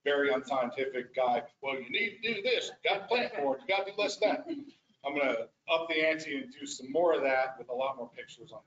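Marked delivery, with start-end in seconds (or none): 1.6-4.5 higher-pitched ranting imitation; lightly put-on lecturing rant; mock rant. Practitioner retelling his unscientific submission, as a mild mock rant. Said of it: "basically a big rant".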